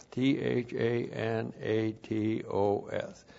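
slow, soft spelling T H A N A T O S letter by letter; each letter of THANATOS said apart, slowly; spelled out. Shown as a demonstration. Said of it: "spelled out".

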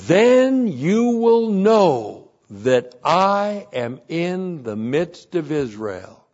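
slow, high, loud opening on Then; scripture read with emphasis. Acted. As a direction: proclaim the verse from the pulpit. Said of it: "verse twenty-seven says".

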